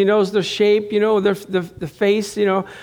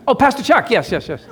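higher, bright burst on Oh, Pastor Chuck; sudden recognition; 5 semitones higher than usual. Retold or imitated. People on the phone recognizing Pastor Chuck, eager burst. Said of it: "They go".

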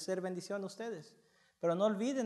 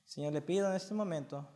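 prayer; 2 semitones lower than usual. Acted. Opening prayer. (oración pausada y reverente)

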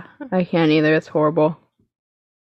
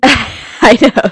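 laughing; 6 semitones higher, 6 dB louder than usual. Real, not acted. Laughs at tissue remark.

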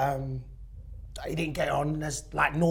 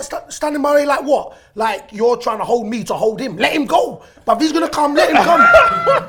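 higher, louder, laughing as it builds; heated retelling; 6 semitones higher, 9 dB louder than usual. Retold or imitated. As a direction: relive the standoff, cracking up at the end. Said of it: "And I'm like".

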